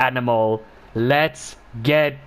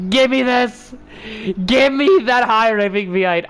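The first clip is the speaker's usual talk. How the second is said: eager repeat; 6 semitones higher than usual. Real, not acted. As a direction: push "Give" up with craving. Craving the car's V8.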